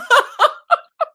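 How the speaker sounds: big burst of laughter, high and loud. Real, not acted. Reading a viewer comment, bursts into loud laughter. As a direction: crack up at the comment.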